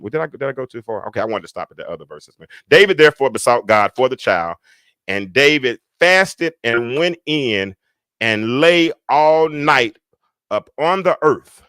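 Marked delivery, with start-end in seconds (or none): 2.7-11.6 slow, loud, drawn-out scripture reading; emphatic pulpit reading. Real, not acted. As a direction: proclaim the verse phrase by phrase. Rereads the verse aloud.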